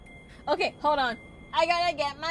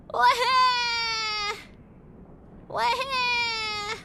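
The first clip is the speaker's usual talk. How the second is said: mock wail; 7 semitones higher, much slower than usual. Fake crying noise.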